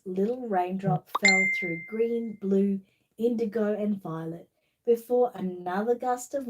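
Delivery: slow, lively storybook reading; reading to children. Acted. Reading The Little Raindrop to child viewers, storybook voice. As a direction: read it like bedtime story time. Reads picture book to children.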